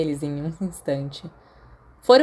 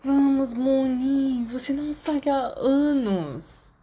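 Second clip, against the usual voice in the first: higher, lighter voice for Sirius; a higher, teasing schoolboy voice; coaxing friend; 8 semitones higher, slower than usual. Acted. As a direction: coax him out, playful and pleading.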